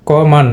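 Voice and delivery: "কমান" with a strong first vowel and schwa after it; "কমান" with unstressed schwa in the last syllable; schwa pronunciation. Shown as a demonstration. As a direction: hit the first syllable of "কমান", weaken the ending to -man. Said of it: "এটা কিন্তু শোয়া sound".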